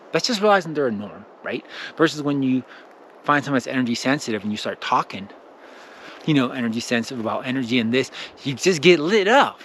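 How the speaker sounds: ordinary delivery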